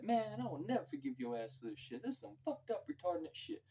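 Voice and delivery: high, indignant complaining; mock outraged Jules. Jules to Vincent, cleaning the car, in high mock outrage. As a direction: squeal out the disgust.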